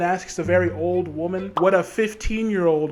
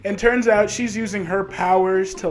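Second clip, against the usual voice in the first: ordinary delivery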